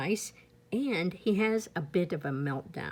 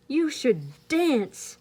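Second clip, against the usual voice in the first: porcupine; 6 semitones higher, 4 dB louder, much slower than usual. Acted.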